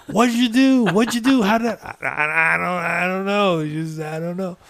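a mock-tearful panic, voice way up; panicked crying wife. Wife crying at husband over hurt child, high and loud. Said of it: "She starts crying".

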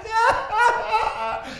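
high, soft imitated wailing; mock crying. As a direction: wail in pulses like someone weeping. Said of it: "Peter cried".

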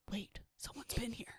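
imitating a suspicious whisper; very soft, breath-only mock alarm; hushed realisation. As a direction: whisper it as if suddenly catching a scent. Acting out a sudden realisation.

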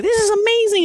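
squealing way up high with delight; breathless high-pitched wonder; thrilled squeal. Retold or imitated. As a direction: gush like a giddy child. Thrilled when a bird lands on him.

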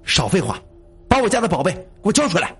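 angry, quick, high-pitched demand; flustered anger. A narrator's reading. Zhang San demands the family treasure, flustered and angry. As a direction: cut the pleasantries off in a temper. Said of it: "张三儿气急败坏".

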